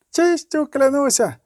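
put-on high, slow young knight's oath; youthful knight, pitched well up; hoarse young knight. A narrator's reading. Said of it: "сипів молодим голосом другий".

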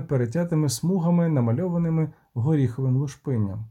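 usual speaking voice

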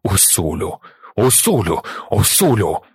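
understated cry, building over repeated name; mildly distraught, each call falling away; anguished cry. A narrator's reading. Stilgar grieves for his blinded friend, mildly anguished. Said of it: "зойкнув Стілгар".

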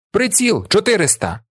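high barked military order; crackling command. A narrator's reading. Officer ordering his boy soldiers to aim, crackling commanding voice. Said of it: "командує той самий тріскучий голос".

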